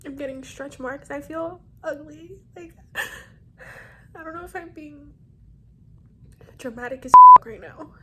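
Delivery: crying while venting. Real, not acted. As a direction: voice rising into real tears. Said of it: "cried like this".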